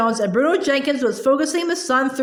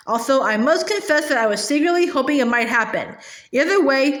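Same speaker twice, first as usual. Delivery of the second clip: ordinary delivery, nothing marked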